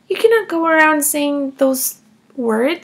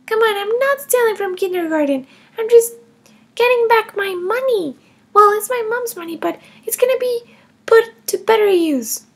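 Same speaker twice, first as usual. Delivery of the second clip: put-on higher, defiant little girl voice; child Emma; 6 semitones higher than usual. Kindergartner Emma defending taking her mom's money, higher child voice. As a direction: play a cheeky kindergartner justifying herself.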